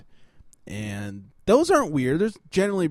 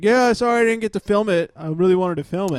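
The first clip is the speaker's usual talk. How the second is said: higher, louder imitation of a giddy non-apology; unbothered, amused mock apology; 6 semitones higher, 5 dB louder than usual. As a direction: play a tipsy friend who isn't sorry. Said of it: "number one laughing about it".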